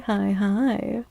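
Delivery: usual speaking voice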